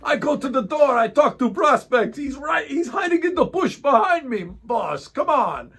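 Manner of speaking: put-on high shouted Bosnian-accented rant; shouting Bosnian accent. Driver shouts to the boss about being followed, Bosnian accent. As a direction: yell it like an exasperated Bosnian driver. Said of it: "in his Bosnian accent".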